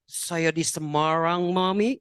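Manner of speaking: slow, drawn-out man's reply; put-on man's voice on the phone; grown man's voice. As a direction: imitate a son's grown-up man voice. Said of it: "suaranya kayak om-om".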